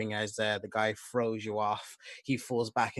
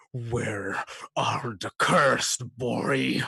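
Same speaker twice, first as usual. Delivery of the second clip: slow, menacing growl; loud growling voice; 2 semitones higher, 8 dB louder, much slower than usual. Acted. Growling attacker interrogating the brother, loud growl. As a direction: growl it out loud, stretching each word. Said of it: "this loud growling voice just say".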